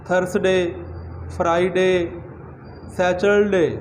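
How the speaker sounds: everyday local pronunciation; common pronunciation. Shown as a demonstration. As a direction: read the days as commonly taught. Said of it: "जिस तरह के हम आम तौर पर बच्चों को सिखाते हैं".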